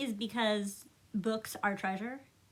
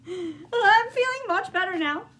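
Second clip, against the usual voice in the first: perky Frog; 7 semitones higher, 6 dB louder than usual. Acted. Frog perks up.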